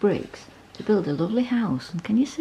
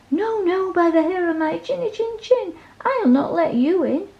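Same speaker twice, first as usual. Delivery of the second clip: high, squeaky little pig, defiant; a high piggy refusal, louder; 12 semitones higher, 5 dB louder than usual. A narrator's reading. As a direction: pitch it way up like a tiny stubborn pig.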